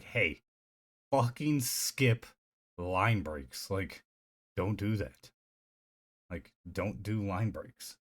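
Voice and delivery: exasperated order. Retold or imitated. Streamer scolding the text-to-speech app, slow and exasperated. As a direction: spell it out slowly to a stubborn program.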